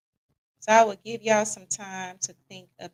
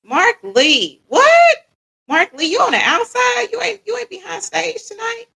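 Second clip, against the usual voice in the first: excited call-out; 10 semitones higher, 9 dB louder than usual. Real, not acted. Calling out to a friend.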